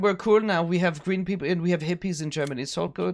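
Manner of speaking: mellow German hippie; relaxed mock-German patter; German accent. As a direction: imitate an easygoing German friend. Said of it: "German can be really angry or really camp".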